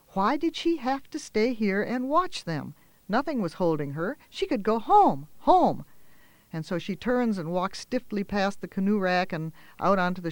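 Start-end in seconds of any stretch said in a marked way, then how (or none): 4.3-5.8 higher, louder, rising on home; relieved burst of longing; sudden hope. A narrator's reading. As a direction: grab the idea and repeat it. Sudden thought of escape.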